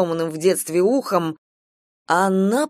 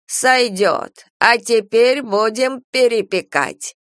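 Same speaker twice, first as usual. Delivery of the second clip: raised, slow announcement; proclaiming; 5 semitones higher, 4 dB louder, slower than usual. A narrator's reading. Old woman announcing the re-baking, proclaiming. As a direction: announce it like a ritual step. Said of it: "возвестила старуха".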